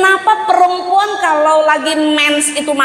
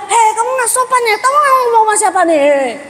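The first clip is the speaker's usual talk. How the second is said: put-on higher, shouted scolding of a child; mock angry scolding; 7 semitones higher than usual. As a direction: snap at the kid, hammed up. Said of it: "bukan bilang". (orang tua membentak anak yang bicara keras, dibuat-buat)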